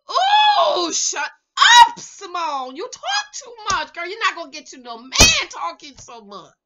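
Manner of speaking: high, loud outburst easing down; exasperated scolding. Acted. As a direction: cry out, then lecture her. Scolds Simone as if present.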